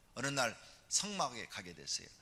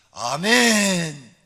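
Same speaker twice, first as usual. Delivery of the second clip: a loud, very slow stretched amen; drawn-out amen; 6 semitones higher, 18 dB louder, much slower than usual. Retold or imitated. Hannah answering Eli's blessing with a long loud amen. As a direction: hold the amen long and full.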